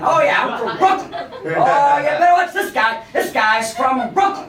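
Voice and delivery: put-on loud, high brash Brooklyn accent; thick Brooklyn accent, cocky and loud. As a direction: play the bragging Brooklyn guy, Brooklyn accent. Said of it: "He kept saying".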